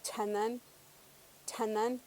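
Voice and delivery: chanán with a puff of air after the ch; aspirated ch. Shown as a demonstration. Teacher saying a Purépecha word with aspirated ch. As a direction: breathe out on the ch of chanán. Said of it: "palabras como chanán".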